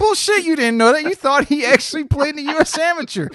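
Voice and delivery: high, loud calling-out; loud mock outrage; incredulous retold protest. Retold group calling out his bluff.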